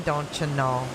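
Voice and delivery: low Midwestern "don't you know"; playful Minnesota-style accent; mock Upper Midwest accent. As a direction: tease in a northern Midwest accent. Teasing Canadian-sounding northern accent.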